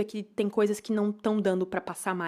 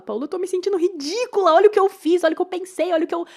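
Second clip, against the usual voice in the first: put-on much higher, louder childlike whine; childish whine; 9 semitones higher, 6 dB louder, faster than usual. To therapist Paula, high childish whining complaint. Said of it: "eu me vejo da forma mais infantil".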